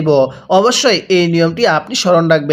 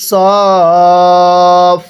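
সফ held long and loud; long drawn recitation; 5 dB louder than usual. Shown as a demonstration. Teacher modelling a four-count madd for students. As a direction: stretch the সদ loudly before ফ. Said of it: "পড়ুন।".

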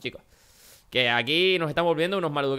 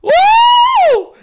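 loud high whoop; 14 dB louder than usual. Real, not acted. Jumping from the top.

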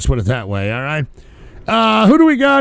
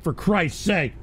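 ordinary talk

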